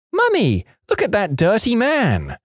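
high-pitched imitation of a small child piping up; piercing little child voice. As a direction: pipe up like a piercing little kid. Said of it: "in my piercing little voice".

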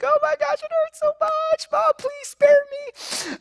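high, hammed-up mock sobbing plea; fake crying. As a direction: whimper like a kid faking tears to dodge a spanking. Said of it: "I was kind of doing the fake crying thing".

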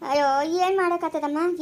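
ordinary delivery

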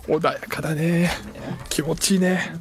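soft, slow, contented; relaxed sigh of contentment; calm and soothed. Real, not acted. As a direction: savor the calm quietly.

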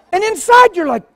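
normal talking voice

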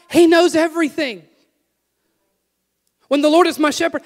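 rising revival preaching; preaching at full force. Acted. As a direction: lift the room with each line. Sermon climax building up.

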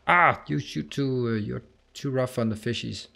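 ordinary talk

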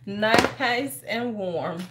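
NICE stretched long and slow; very slow, lingering "Nice and warm"; drawn out. Real, not acted. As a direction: savor it, dragging out "Nice". Repeats it, savoring the warmth.

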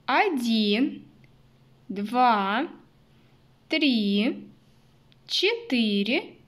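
put-on slow counting for little kids; counting with toddlers. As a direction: count aloud, building to a bright "Много". Counting bunnies with children. (счёт вслух для малышей)

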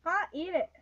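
loud gloating gasp and laugh; gleeful shout. Real, not acted. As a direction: crow it out, triumphant. Teasing triumph, daring friend.

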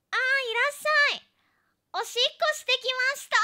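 high, slowed-down, shouted welcome; loud greeting. Retold or imitated. Streamer retelling how she greeted her Japanese friend, loud and high.

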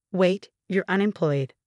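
ordinary delivery

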